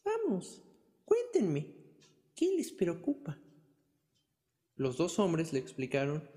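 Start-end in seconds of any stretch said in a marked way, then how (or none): 0.0-3.4 put-on high, slow coaxing old lady; old woman voice. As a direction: coax it out like a granny. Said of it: "respondió la vieja".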